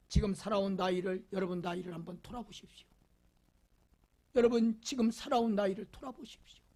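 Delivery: ordinary delivery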